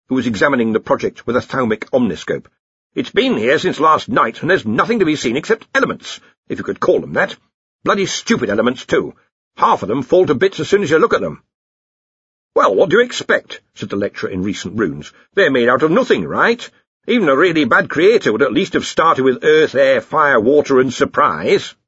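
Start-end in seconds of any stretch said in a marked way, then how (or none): 2.9-11.4 lightly voiced blustering boss; grumbling Arch-Chancellor. Arch-Chancellor complains about stupid elements, mildly blustering. 12.6-13.6 exasperated lecturer. Acted. Voices the lecturer. 15.3-21.8 higher, louder arguing don; opinionated lecturer. Acted. Lecturer scoffs at elements made of nothing, opinionated.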